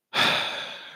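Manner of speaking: long breathy exhale; heavy sigh. Real, not acted. Doubting whether to continue the streams, heavy sigh. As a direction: let out a long, weighty breath.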